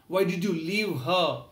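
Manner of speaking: slow pained why did you leave her; anguished question. Acted. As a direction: lean on why, mildly anguished. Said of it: "Rama started crying uncontrollably".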